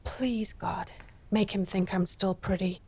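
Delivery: soft, hushed plea to God; whispered prayer. A narrator's reading. Della waiting for Jim, a whispered prayer. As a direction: breathe the prayer under her breath. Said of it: "now she whispered".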